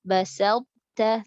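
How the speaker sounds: basalta very slow, t merged into ta; correct basalta. Shown as a demonstration. Teacher demonstrating the correct basalta. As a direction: say basalta slowly with no bounce. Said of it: "to sama ta berdekatan".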